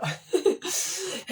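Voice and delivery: high-pitched giggling laugh; real laugh. Real, not acted. Teacher reacting to a viewer's geography correction, amused laugh. Amused after learning something new.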